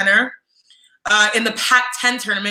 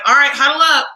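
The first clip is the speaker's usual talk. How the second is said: higher, louder call to huddle; coach Tara's voice; 5 semitones higher, 6 dB louder than usual. Retold or imitated. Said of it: "I always do her voice".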